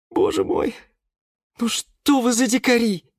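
dismayed, rising to high pitch; exasperated sigh. A narrator's reading. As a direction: sigh at unruly companions. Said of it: "вздохнул Юра". (со вздохом, сокрушённо)

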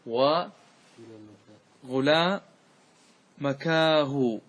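very slow demonstration of مكاه with open long aa; lament with alif. Shown as a demonstration. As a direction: draw out مكاه as a long "kaa-hu". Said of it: "لو طبقنا القاعدة وادخلنا الألف".

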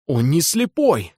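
slow astonished outcry; surprised exclamation. A narrator's reading. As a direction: cry out in shock. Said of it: "удивлённо вскричал Корейка".

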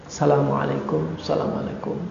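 wife gently closing her prayer, whispered; soft prayer salam. Acted. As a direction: breathe the closing greeting of prayer.

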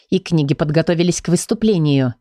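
plain reading voice

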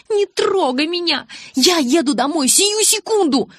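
high, loud, outraged cry; furious shout. Acted. Bella refusing Jacob's help, furious shout. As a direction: shriek at him to back off.

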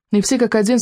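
usual speaking voice

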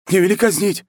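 very high, loud begging; yelled plea. Acted. War chief begs not to be executed, high loud yell. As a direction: yell out for mercy. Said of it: "снова бухнулся незадачливый подчинённый".

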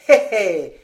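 loud, smug little hé hé; knowing chuckle. Acted. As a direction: sound pleased with yourself. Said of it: "fit Lapierre d'un air capable". (petit rire suffisant et sonore)

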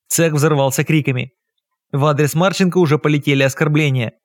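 ordinary delivery, nothing marked

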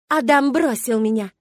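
loud, high, drawn-out accusation; furious outburst. A narrator's reading. Milena vents at the woman she blames, furious. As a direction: blurt it out slowly in loud rage. Milena bursts in, venting her rage.

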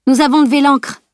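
called out. A narrator's reading. Camille calls to her sleeping friend, shouting. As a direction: call it out to wake her. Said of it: "lui cria Camille".

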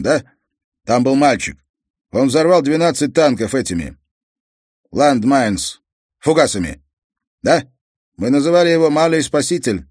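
loud, heavy-handed foreign father's speech; a proud immigrant father complaining. Acted. Croatian father tells the detective about his lost career, loud and blunt.

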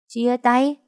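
high, rising echo on Chia tay; disbelieving. A narrator's reading. Friend hears about the breakup, disbelieving. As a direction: throw back Chia tay as an incredulous question. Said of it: "Nó nghe như không tin".

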